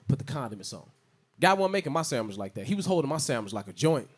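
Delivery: ordinary delivery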